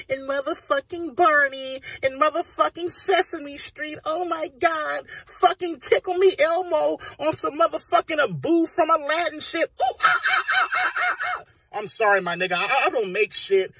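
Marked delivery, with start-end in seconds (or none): 0.1-9.7 squeaky high cartoon voice, mocking; mock kiddie voice. Mocking kids' TV to his friend in a squeaky high voice. 9.8-11.4 shrieking very high monkey ooh-ah sounds; mock monkey chatter. Imitating Abu from Aladdin with screeching monkey chatter.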